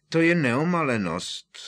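ordinary delivery, nothing marked

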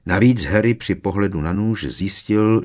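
ordinary delivery, nothing marked

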